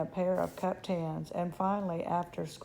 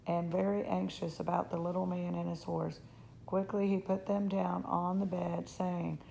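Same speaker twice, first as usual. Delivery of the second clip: ordinary delivery, nothing marked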